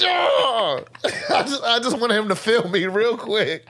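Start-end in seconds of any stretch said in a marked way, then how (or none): 0.1-0.8 high strangled cry; hammed-up angry yelp; mock rage squeal. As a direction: squeal through clenched fury.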